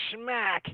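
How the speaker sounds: high, punched-out "smack"; spoken smack sound. Acted. Game master describes a glaive hit, high punchy smack. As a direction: snap out the word like a blow landing. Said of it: "You're like".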